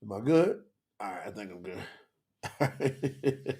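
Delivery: normal talking voice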